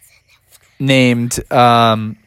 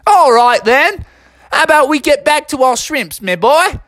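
high, hammed-up Aussie accent; put-on Australian kangaroo voice; back into Australian; 12 semitones higher than usual. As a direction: switch the broad Australian back on, pitched up. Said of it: "went back into his accent and said".

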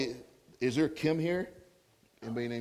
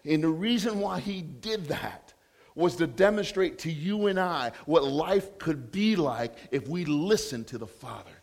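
normal talking voice

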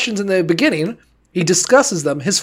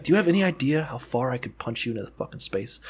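low, much softer menacing God; a hint of a tough-guy God, softer; mock God threat; 6 semitones lower, 12 dB quieter than usual. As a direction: threaten quietly from the back seat. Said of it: "He's like".

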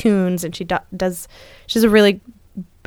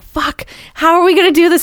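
panicked worry; 10 semitones higher, 4 dB louder than usual. Retold or imitated. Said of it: "I was like".

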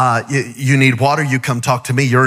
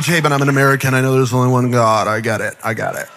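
lower, offhand mock of a skeptical listener; dismissive churchgoer; 8 semitones lower, faster than usual. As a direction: brush it off like you've heard it all. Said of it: "'cause you're going".

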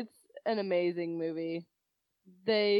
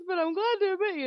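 1 mock Dora; 10 semitones higher, faster than usual. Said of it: "then she goes".

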